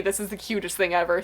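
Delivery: normal talking voice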